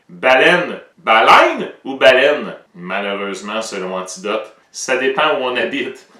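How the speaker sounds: usual speaking voice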